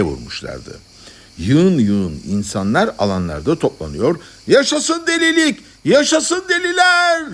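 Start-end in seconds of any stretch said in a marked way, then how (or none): 4.5-7.2 crowd shouting. A narrator's reading. Crowds gathered in the squares, shouting in acclaim. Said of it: "diye bağırıyorlardı".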